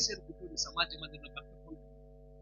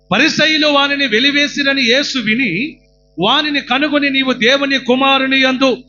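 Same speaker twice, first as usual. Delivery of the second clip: loud, high preaching cry; fervent sermon shout; full-force preaching; 4 semitones higher, 16 dB louder than usual. Acted. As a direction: exhort the crowd with all your voice. Preacher reads verse loudly.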